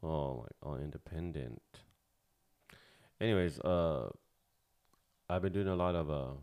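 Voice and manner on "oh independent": normal talking voice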